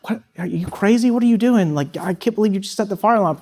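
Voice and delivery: mock disbelief, why would you do that; roommate's disbelief. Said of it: "looked at me like".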